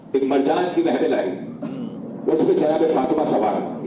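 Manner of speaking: usual speaking voice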